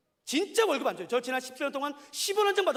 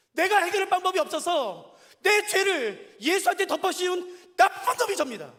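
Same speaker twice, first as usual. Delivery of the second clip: louder, higher, slow preaching; slow loud confession; 6 semitones higher, 6 dB louder, slower than usual. Real, not acted. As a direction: confess it with full force, slowly. Preaching confession at full force.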